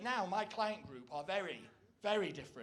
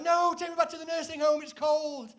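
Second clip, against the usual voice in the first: put-on much higher, louder, frail old man complaining; David Attenborough; 9 semitones higher, 11 dB louder than usual. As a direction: whine like a cold, frail old presenter. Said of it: "he'll be like".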